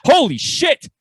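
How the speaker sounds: high-pitched hammed-up alarm with a laugh in it; freaking-out doctor. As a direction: blurt it like a doctor who lost his nerve.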